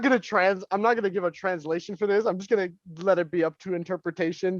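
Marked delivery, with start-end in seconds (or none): none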